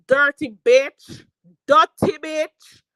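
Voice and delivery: drawn out, loud contemptuous insult; slow loud insult. Real, not acted. Insulting a rival streamer, slow loud contempt. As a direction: spit each word slowly and loud.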